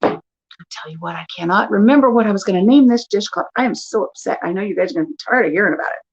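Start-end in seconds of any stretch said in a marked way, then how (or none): none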